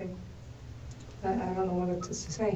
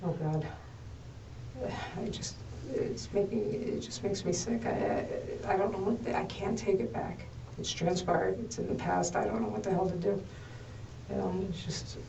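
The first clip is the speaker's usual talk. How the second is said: quiet distressed; 11 dB quieter than usual. Real, not acted. Upset suspect under interrogation.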